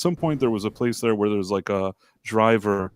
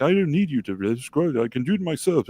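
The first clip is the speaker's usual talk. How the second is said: higher-pitched mock British accent; 3 semitones higher than usual. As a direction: imitate an annoyed woman's nice British accent. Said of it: "in this nice British accent".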